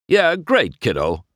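brusque, high then dropping; dismissive interruption; cutting him off. A narrator's reading. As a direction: brush the kid off. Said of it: "cutting off McQueen".

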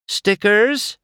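high, searching call. A narrator's reading. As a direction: call a nickname over a crowd. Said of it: "Sally called".

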